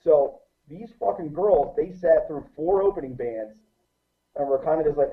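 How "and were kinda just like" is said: normal talking voice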